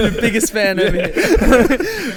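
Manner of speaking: slow, loud teasing laugh. Real, not acted.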